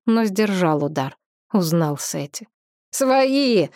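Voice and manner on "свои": quick raised call, higher in pitch; belated warning. A narrator's reading. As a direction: call out to stop a friend's blow. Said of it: "запоздало проговорил рыжеволосый".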